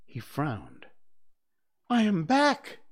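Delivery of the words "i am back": high, loud indignant announcement; heated, loud declaration; hotly. A narrator's reading. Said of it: "he said hotly".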